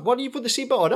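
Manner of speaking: high, loud exasperated telling-off; exasperated scolding. Retold or imitated. Dad scolding the kids after the sudden brake, exasperated. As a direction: scold them like a fed-up dad.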